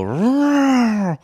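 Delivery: high, hammed-up cartoon roar; mock roar. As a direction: roar like a silly monster. Mock roaring at Trump.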